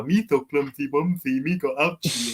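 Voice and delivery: lisping goofy baby talk, lightly voiced; a hint of the clumsy alien whining; mock childish lisp; Jar Jar baby talk. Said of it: "but then you have Jar Jar".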